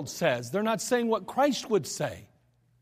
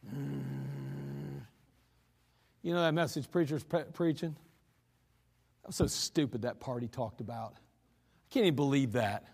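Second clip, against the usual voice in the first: mock griping; 6 semitones lower, 7 dB quieter than usual. Same churchgoer griping on drive home.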